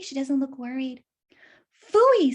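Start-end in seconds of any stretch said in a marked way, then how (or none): 1.9-2.3 louder, higher exclamation of disgust; fox's annoyed phooey. Acted. Fox at the cliff edge, loudly disgusted. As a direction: spit it out, fed up. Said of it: "said the plump gray fox".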